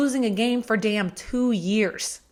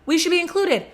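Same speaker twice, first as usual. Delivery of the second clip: higher, louder bragging fan voice; mock Alabama fan; 6 semitones higher, 3 dB louder than usual. Fan mocks Alabama bragging about beating Georgia, higher mock voice. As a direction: puff up like a smug rival fan. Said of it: "you could say".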